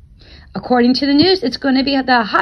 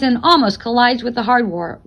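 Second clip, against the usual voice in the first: usual speaking voice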